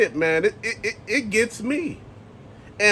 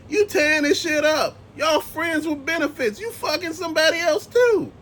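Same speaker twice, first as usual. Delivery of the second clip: incredulous retort; 7 semitones higher than usual. Retold or imitated. Imagined retort to the video lady.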